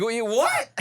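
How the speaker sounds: high-pitched startled reaction; mock bewilderment, pitched right up; retold shock. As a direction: act out being caught off guard by a voice. Retold shock at talking chatbot.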